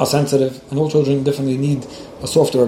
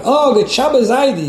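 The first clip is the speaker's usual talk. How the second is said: higher, louder, drawn-out mock shout of greeting; retold shout; 10 semitones higher, 4 dB louder, slower than usual. A grandson greets his grandfather with a loud, slow mock call. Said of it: "this one screamed out".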